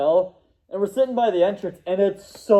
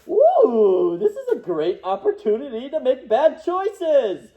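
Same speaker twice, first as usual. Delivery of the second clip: mischievous glee; 6 semitones higher than usual. Retold or imitated. Mock inner voice, tempted.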